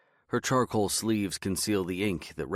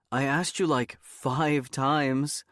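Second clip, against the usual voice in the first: exasperated; 7 semitones higher, 3 dB louder, slower than usual. A narrator's reading. Partner scolds forgetful husband in story.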